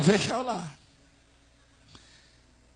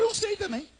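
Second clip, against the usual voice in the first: high, soft little voice; sheepish confession; 12 semitones higher, 7 dB quieter, faster than usual. Retold or imitated. Pastor confessing to the bishop he doesn't know either, sheepish confession. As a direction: shrink the answer to a small high murmur.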